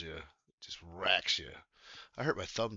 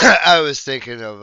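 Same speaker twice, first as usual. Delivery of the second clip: much louder, higher start still laughing; excited recall; 6 semitones higher, 21 dB louder than usual. Real, not acted. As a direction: blurt it out eagerly.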